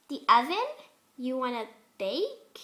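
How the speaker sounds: bouncy pony, confused; tilted-head question; lightly voiced curious pony; puzzled Pinkie.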